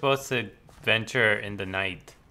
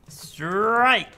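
umpire call; 8 semitones higher than usual. Pretend. Calling a strike as umpire.

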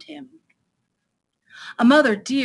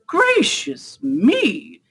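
slow, high-swooping astonishment; surprised exclamation; 5 semitones higher, 3 dB louder, much slower than usual. Acted. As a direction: stretch it out in amazement. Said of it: "he said in surprise".

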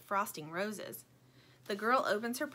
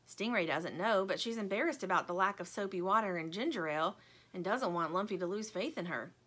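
usual speaking voice

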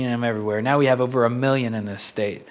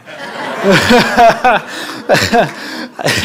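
amused laugh; 8 semitones higher, 5 dB louder than usual. Real, not acted. Laughing at own story.

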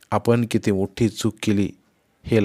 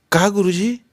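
put-on puzzled boy; 10 semitones higher, 4 dB louder than usual. A narrator's reading. Boy asks teacher, puzzled.

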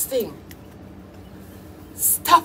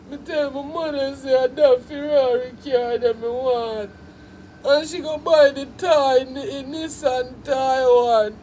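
mock whiny artist; 3 semitones lower than usual. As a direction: whine it back at him like a spoilt boy. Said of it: "You sound like a bumbo clot idiot".

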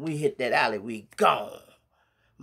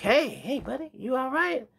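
much higher, friendly call of a police officer; cop calling out; 13 semitones higher than usual. Retold or imitated. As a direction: call over to a stranger like a cop checking on him. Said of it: "They like".

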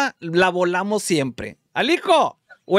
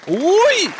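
sudden high yelp at a near miss; high, loud "uy" of surprise; 13 semitones higher, 6 dB louder than usual. Real, not acted. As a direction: exclaim a startled "uy".

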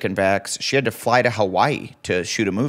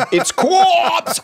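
high, loud burst; loud shout; 4 dB louder than usual. Acted. Co-host yelling the word for laughs, high loud shout. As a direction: belt it out high for comic effect.

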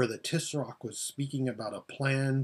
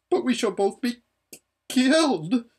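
high, loud terrified wail; 10 semitones higher, 6 dB louder than usual. Acted. Lasaraleen cries out that they will both be killed. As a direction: wail it in panic.

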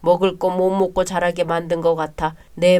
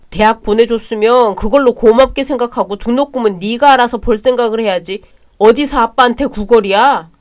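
angry scolding; 2 semitones higher, 6 dB louder than usual. Acted. Brother scolds over tuition.

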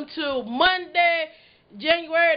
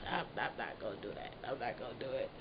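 much softer, lower mutter; quiet mutter; 17 dB quieter, faster than usual. Real, not acted. Talking herself out of another rap, low mutter. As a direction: mumble it to yourself.

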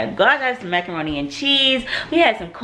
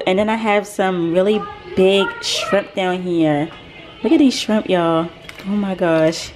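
ordinary talk, nothing marked

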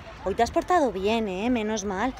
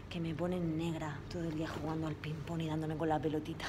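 speaking softly; 5 semitones lower, 12 dB quieter than usual. Real, not acted. Alone in the quiet office.

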